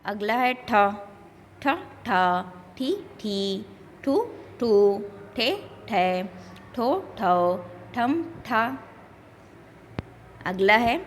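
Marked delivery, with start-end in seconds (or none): 1.6-8.6 softer drill, first "ठी" short, second held; syllable drill. Shown as a demonstration. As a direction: recite aspirated "ठ, ठी, ठू" in short-long pairs. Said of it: "व्यंजन अक्षरों का उच्चारण किस तरह".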